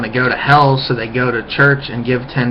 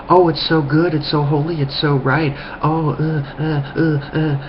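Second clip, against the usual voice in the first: higher, dazed gushing over holiness; mock awed believer; 4 semitones higher than usual. Mocking a dazzled believer, higher and gushing. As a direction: gush like a swooning convert. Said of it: "first thin layer is like".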